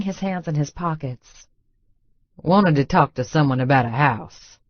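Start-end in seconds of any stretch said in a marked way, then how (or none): 2.4-4.6 lightly voiced drawling Texas man, a touch lower; a hint of a small-town Texas accent. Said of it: "He's got a thick Texas accent".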